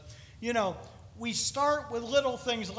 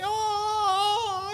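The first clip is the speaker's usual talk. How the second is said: squealed high oh over the babies; gushing over cute kids; 12 semitones higher, 4 dB louder than usual. Retold or imitated. Preacher cooing at cute little children. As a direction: coo like a doting grandparent. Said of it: "I just want to walk around and say".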